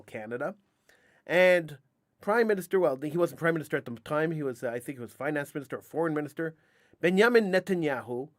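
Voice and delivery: usual speaking voice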